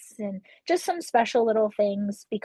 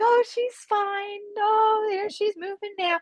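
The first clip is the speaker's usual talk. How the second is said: high, slow tearful relief, much higher and slower than her own voice; relieved crying; 11 semitones higher, slower than usual. Retold or imitated. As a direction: retell the moment you sob with relief. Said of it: "I just start crying".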